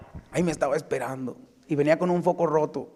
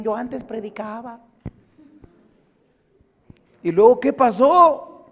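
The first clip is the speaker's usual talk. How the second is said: loud, rising sermon voice; loud, high pulpit preaching building to a climax; full-force preaching; 6 semitones higher, 8 dB louder than usual. Acted.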